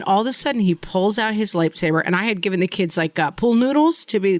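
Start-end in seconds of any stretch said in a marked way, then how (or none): none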